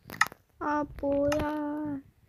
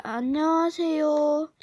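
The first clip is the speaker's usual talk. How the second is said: ordinary talk, nothing marked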